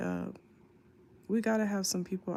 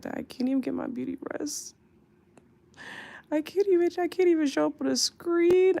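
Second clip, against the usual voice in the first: high, loud wail of complaint; exasperated whine; 8 semitones higher, 3 dB louder than usual. Real, not acted. Sleepless streamer explaining why she's off screen, exasperated whine. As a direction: push it up high and loud, fed up.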